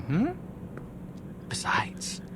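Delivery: breathy coaxing whisper; a conspiratorial whisper; sly whisper. Acted. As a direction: lean in and tempt them softly. Sly wheedling aside.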